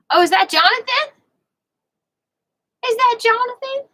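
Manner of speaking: high, loud delighted question; excited greeting. Real, not acted. Host spots Jonathan arriving, excited high greeting. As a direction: pitch it right up, calling out a hello. Greeting someone who just appeared.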